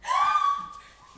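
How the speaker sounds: loud high gasp with a laugh; shouted gasp. Acted. Sudden dramatic gasp.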